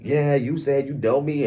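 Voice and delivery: lightly acted challenge; mock bucking attitude. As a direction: mimic someone getting in her face. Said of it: "giving her the energy of".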